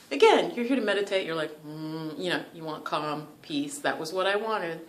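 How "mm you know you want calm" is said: ordinary talk, nothing marked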